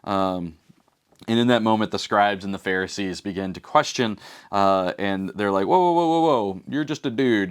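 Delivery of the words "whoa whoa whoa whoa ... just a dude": mock alarmed. Pharisees object to Jesus.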